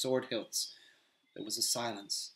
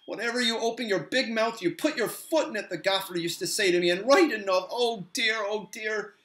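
higher, fretful hobbit voice; flustered hobbit; 9 semitones higher, 6 dB louder than usual. Acted. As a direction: scold himself in dismay.

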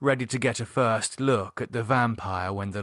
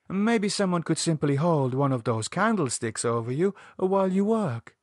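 a hint of a gentle Spanish nurse; Conchita, lightly voiced; 6 semitones higher than usual. Conchita offering help from the stairs, lightly voiced.

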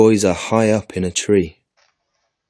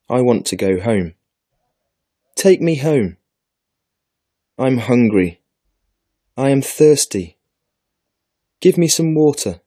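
pouty little girl's plea, mildly acted and higher; pouting; 4 semitones higher than usual. A narrator's reading. Thirsty little girl begging her brothers to go home, mildly pouting. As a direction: lightly pout and plead on a raised pitch.

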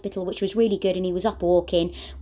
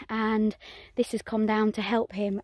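ordinary talk

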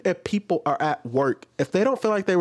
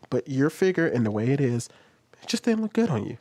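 lower, gentle, measured apology; calm and polite; 7 semitones lower than usual. Shown as a demonstration. Reactor shows how she'd really answer the customer, calm and gentle. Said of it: "If it was really me".

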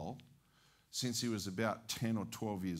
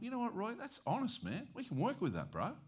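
soft high reassurance; 10 semitones higher, 5 dB quieter than usual. Retold or imitated. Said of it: "I thought".

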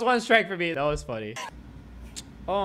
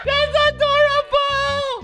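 much higher, loud squeal; delighted shout; 5 dB louder, slower than usual. Real, not acted. Cute dog posing on video, delighted squeal.

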